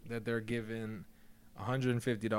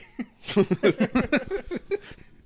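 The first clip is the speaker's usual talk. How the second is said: bursting out laughing; big high-pitched laugh; loud high laugh; 13 semitones higher, 8 dB louder than usual. Real, not acted. Big laugh at security joke.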